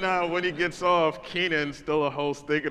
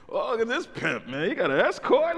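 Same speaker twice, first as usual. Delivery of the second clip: higher and louder, a mock ribbing host; teasing host voice; 10 semitones higher, 7 dB louder than usual. Host Kenan mocking the comic's escort, higher and louder. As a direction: rib the comic like a showy MC. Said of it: "he goes".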